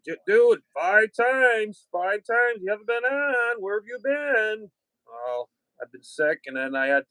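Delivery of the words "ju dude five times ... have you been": higher-pitched, lightly voiced ribbing buddy; imitating Diz. Quoting Diz's teasing complaint, voiced higher. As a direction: play the pushy friend asking where you've been. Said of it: "Diz busts my balls all the time".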